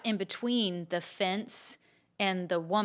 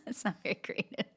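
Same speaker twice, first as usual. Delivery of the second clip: laughing; 7 semitones higher than usual. Real, not acted. Laughing at the partner's joke.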